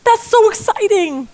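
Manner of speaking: high-pitched, thrilled little rabbit voice; hammed-up excited high voice. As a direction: squeal it in delighted amazement. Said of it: "And the rabbit's like".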